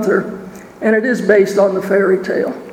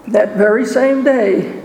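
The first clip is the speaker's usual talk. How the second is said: ordinary delivery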